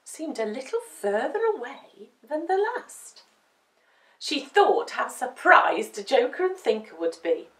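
ordinary delivery

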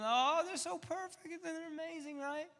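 high, swooning doting-parent voice; gushing parent. Retold or imitated. Parent gushing over the family photos, high and swooning. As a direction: coo over the perfect kids.

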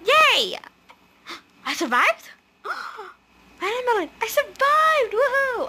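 high, loud, thrilled shouts; excited cheering. Real, not acted. Gamer celebrating that she survived the round, excited.

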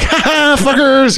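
high gleeful squeal; squealing with joy. Retold or imitated. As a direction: squeal it out like a giddy prankster. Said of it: "running and squealing with joy".

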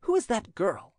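high, abrupt demand; short, curt question. A narrator's reading. Prince questioning his servant about a girl, curt. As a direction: ask it curtly. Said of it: "the latter asked him shortly".

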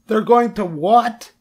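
high, drawn-out shriek of disbelief; horrified scream. A narrator's reading. Wilbur hears he will be killed, horrified high scream. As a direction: squeal it in horror, very high. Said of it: "screamed Wilbur".

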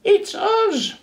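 very high, loud, squeaky sisters; tiny high sister penguins calling. Acted. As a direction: chirp it cheerfully through the door. Sisters at the door.

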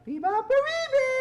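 put-on very high baby bear squeak. Shown as a demonstration. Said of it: "Baby bears".

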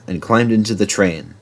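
ordinary delivery, nothing marked

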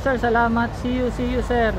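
ordinary delivery

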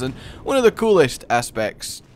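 ordinary delivery

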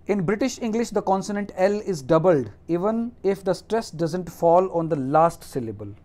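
slow, deliberate dictation of the rule; reading a rule aloud. Acted. Teacher dictates the English spelling rule to students, slow and deliberate. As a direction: read it out slowly, word by word.

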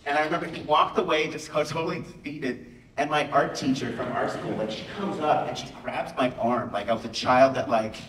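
ordinary delivery

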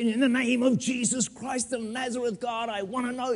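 high, hammed-up churchy prayer; mock a stiff professional prayer, pitched high; formal pompous prayer. Preacher mocking formal prayers to the church, high and pompous. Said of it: "You don't need professional prayers".

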